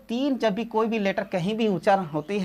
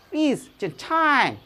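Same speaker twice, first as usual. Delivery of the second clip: higher-pitched mock English-American accent, final t swallowed; imitated English-American speaker; 4 semitones higher than usual. Teacher quoting a foreigner in Kolkata, imitated English-American accent. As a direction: mimic a native English speaker's quick request. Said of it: "English American भी आए हुए थे".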